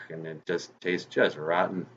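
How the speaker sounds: plain reading voice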